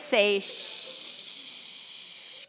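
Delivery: long drawn-out shush; hushing. Real, not acted. Speaker settling a noisy audience, hushing. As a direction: drop down into a long, airy hush.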